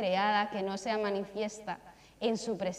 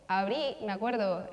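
ordinary talk, nothing marked

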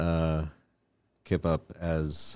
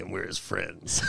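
slow, softer sidekick chorus; cartoon sidekick voice; 9 dB quieter, slower than usual. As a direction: draw it out quietly as cartoon friends. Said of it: "whose voice is that?".

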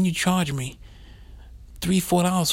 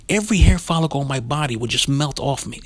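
usual speaking voice

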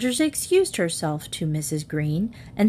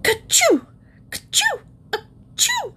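big sneezes. A narrator's reading. Missus Rogers hit by pepper, big sneezes. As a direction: sneeze hard three times. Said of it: "She sneezed".

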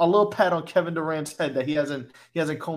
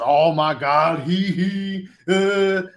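mock fan dismay; 5 dB louder, much slower than usual. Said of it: "It was".

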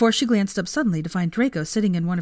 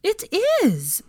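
exclaimed; 13 semitones higher than usual. A narrator's reading. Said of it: "exclaimed Hermione".

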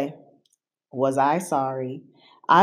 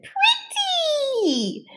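put-on much higher, bright little-girl cry with a laugh; delighted little girl. A narrator's reading. Emma admiring the monster's tail, high and laughing. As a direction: squeal with joy at the monster. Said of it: "Emma blinked and said".

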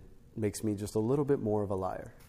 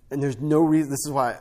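emphatic; 5 semitones higher, 7 dB louder than usual. Real, not acted. Preaching burst.

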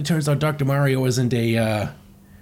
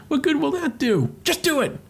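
much higher cartoon voice; squeaky comic bickering; 13 semitones higher than usual. Arguing over Mario's plan, high mock bickering. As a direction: act out a cartoon squabble up high.

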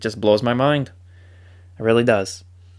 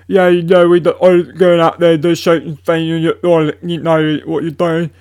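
mock heavy mumbled British accent, higher and louder; Harry Kane's British accent; 6 semitones higher, 7 dB louder than usual. Footballer mumbling through an interview, mock thick British accent. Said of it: "Harry Kane's British accent is so strong".

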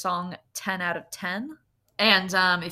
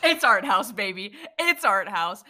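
excited; 6 dB louder than usual. Real, not acted. Triumphant verdict announcement.

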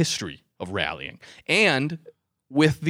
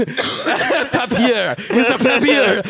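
put-on high, laughing French-accented "papier"; 9 semitones higher, 5 dB louder, much slower than usual. Host mocks own slip on a French newspaper, laughing French accent. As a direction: crack up while putting on a French accent. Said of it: "my accent too soon".

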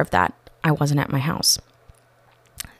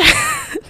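high delighted laugh. Real, not acted. Laughing at the surprise.

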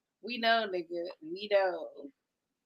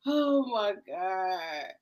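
normal talking voice

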